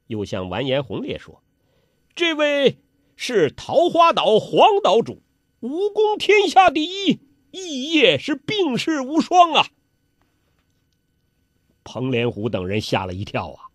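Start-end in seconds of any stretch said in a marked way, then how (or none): 2.2-9.7 very high, extolling announcement; grand introduction. A narrator's reading. Host presenting the island master to a prince, grandly extolling. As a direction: build the guest up with ringing praise.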